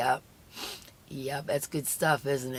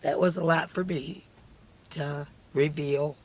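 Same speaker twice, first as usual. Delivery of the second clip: snotty crying; 2 semitones higher, 4 dB louder than usual. Real, not acted. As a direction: push the words out through tears. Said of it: "I'm ugly crying over here".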